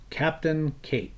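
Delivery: usual speaking voice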